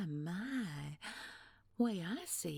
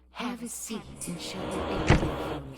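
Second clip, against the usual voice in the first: loud command; 9 dB louder than usual. Acted. Villain ordering sharply.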